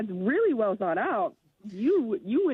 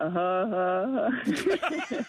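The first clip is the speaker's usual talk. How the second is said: slow, mechanical huh huh huh laugh; robot laugh; slower than usual. Shown as a demonstration. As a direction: laugh like a stiff robot, one beat at a time. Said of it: "The robot laugh".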